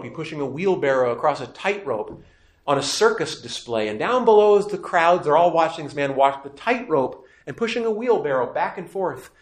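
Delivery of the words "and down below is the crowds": ordinary delivery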